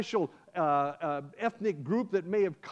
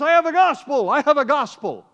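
loud ringing proclamation, much higher; proclaiming shout; 8 semitones higher, 11 dB louder than usual. Acted. Brother shouting at the girl's door, loud proclamation. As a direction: call it out through the door.

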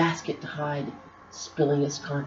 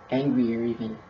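usual speaking voice

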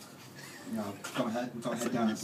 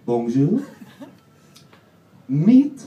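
put-on French accent; 5 semitones higher, 15 dB louder, much slower than usual. Another kitchen voice.

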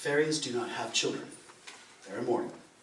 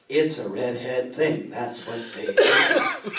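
slow, drawn-out, louder refrain; slow punchline; 6 dB louder, slower than usual. Acted. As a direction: land the catchphrase slowly for the crowd.

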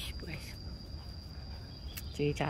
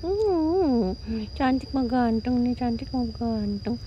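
higher, slow cooing to a kitten; 4 semitones higher, slower than usual. Real, not acted. Owner croons to the kitten, higher slow baby talk.

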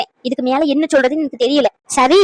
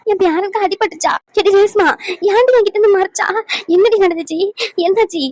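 alarmed cry; 7 semitones higher than usual. Acted. Grandmother learns grandson was hurt.

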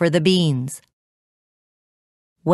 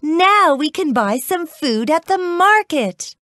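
high-pitched, joyful exclamation; happy shout; 11 semitones higher than usual. A narrator's reading. Mother sees the gold coins, shouting happily. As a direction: cry out with delight. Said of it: "She shouted with happiness".

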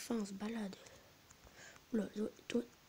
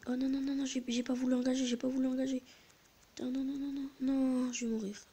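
frantic high pleas of no no no; panicking as the fight turns bad; gaming panic; 4 semitones higher, 3 dB louder than usual. Real, not acted. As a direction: gamer yelping as he is about to get killed. Panicking as enemies close in.

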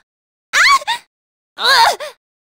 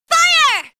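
high firing command; 8 semitones higher than usual. Acted. Character gives fire order.

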